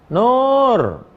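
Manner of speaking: a high, far-carrying call of a daughter's name from another room; mother calls her daughter mid-prayer. Retold or imitated. Mother calls out to Nur during prayer, a high call. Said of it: "Ah, berhentilah".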